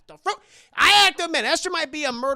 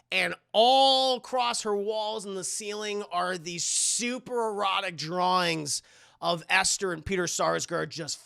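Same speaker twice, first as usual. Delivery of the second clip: normal talking voice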